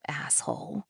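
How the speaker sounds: soft unvoiced whisper under the breath; whispered insult. A narrator's reading. Narrator's muttered insult.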